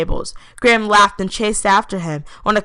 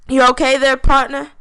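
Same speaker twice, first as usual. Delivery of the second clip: put-on country accent; 7 semitones higher, 6 dB louder than usual. Graham checks on breathless Turner in a country accent. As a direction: play it like a country cowboy accent. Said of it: "Graham said in a country accent".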